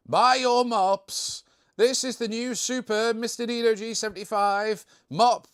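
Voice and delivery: high, loud street-vendor cry; mock sales pitch. Streamer pretends to sell mops instead, mock sales pitch. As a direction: hawk it like a joke advert.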